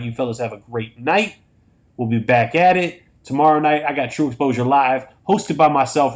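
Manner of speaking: ordinary talk, nothing marked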